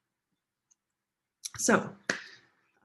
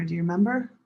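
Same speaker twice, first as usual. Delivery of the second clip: normal talking voice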